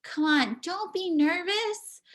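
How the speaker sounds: normal talking voice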